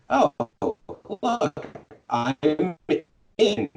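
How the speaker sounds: high-pitched pretend surprise; mock delighted realisation. Acts out character's pleased discovery.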